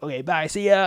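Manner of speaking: light California surfer dude voice. Retold or imitated. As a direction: toss off a carefree California bro farewell. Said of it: "California accent".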